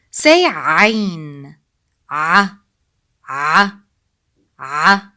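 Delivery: ain voiced from the middle of the throat, smooth; Arabic ain. Shown as a demonstration. Teacher modelling the ain sound for students. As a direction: squeeze a from mid-throat for ain. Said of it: "That's where ain sound comes from".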